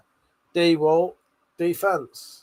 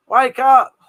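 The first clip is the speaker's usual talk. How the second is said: loud high yell to rouse sleepers; sudden loud call, pitched higher; shouting wake up; 6 semitones higher, 10 dB louder than usual. Real, not acted. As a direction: bellow it to wake everyone up. Yelling at chat.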